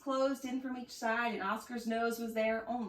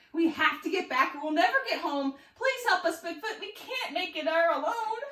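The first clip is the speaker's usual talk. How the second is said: higher and louder, urgent begging; panicked plea; 6 semitones higher, 4 dB louder than usual. A narrator's reading. Klaus begging Bigfoot for help, higher and urgent. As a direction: beg for help in a hurry. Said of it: "Klaus shouted with panic".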